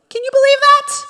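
very high, loud mock outrage; indignant victim. Preacher playing offended Peter complaining to his buddies, mock indignation. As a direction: squeal it in exaggerated disbelief. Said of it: "the response of a victim".

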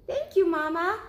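high, small cub voice; little cub asking. Acted. Cub asking mother about sleeping outside, high little voice. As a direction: ask like a curious little bear cub. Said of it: "said Baby Bear".

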